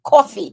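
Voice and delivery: "Coffee" as a quick "cawfee"; New York coffee. Shown as a demonstration. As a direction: say "Coffee" with a rounded "aw". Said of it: "I might say coffee".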